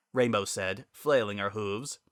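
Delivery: usual speaking voice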